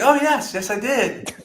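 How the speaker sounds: high, bright-eyed imitation of a proud salesman; mock-cheerful confirmation; eager reply. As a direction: jump in loudly, pitch way up. Acted eager reply of company man.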